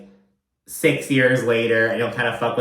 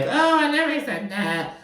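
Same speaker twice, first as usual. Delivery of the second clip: high, airy mock of a flip-flopper; lightly voiced husband denying it; high sing-song denial. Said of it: "but he's like".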